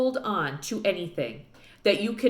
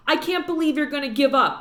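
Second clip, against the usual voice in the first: high, fast indignant protest; 6 semitones higher, 4 dB louder, faster than usual. A narrator's reading. Girl scolds her father for quitting, fast and indignant. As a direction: accuse him of giving up. Opal argues with preacher.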